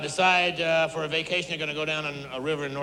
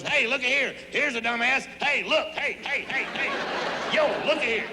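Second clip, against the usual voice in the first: higher, taunting carnival barker calling out; mocking sign voice; 6 semitones higher than usual. The neon sign taunts passing drivers, mocking barker call. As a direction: point and jeer at the Georgia car. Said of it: "a big neon sign on the back of your car that goes".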